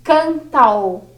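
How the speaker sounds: "cantal" said as one run-together word, canta fused with a; "cantal" pronunciado juntando as sílabas; merged syllables. Shown as a demonstration. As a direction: join "cantal" into a single beat. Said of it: "Então, fica".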